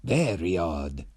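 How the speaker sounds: slow, lightly voiced villain; a hint of a mild villain; villain echoing the hero; villain agreeing. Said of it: "said the villain".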